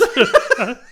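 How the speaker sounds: word breaks into loud high laughter; cracking up mid-word; laughing. Real, not acted.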